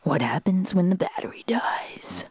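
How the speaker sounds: dad impression. Daughter showing dad how he sounds, whispered question. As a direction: hush it like a puzzled dad. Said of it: "this is what you sound like".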